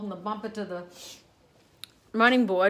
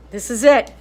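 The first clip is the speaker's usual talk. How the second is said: excited; 11 dB louder than usual. Real, not acted. Building up to test the light.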